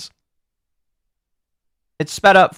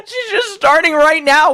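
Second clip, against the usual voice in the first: laughing; 13 semitones higher, slower than usual. Real, not acted. Laughing at clip, high far.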